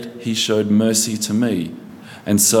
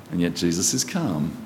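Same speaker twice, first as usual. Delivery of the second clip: softer, lower, settled and calm; quiet calm; 5 semitones lower, 6 dB quieter than usual. Real, not acted. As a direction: let it drop quiet and steady. Calm restated against chaos.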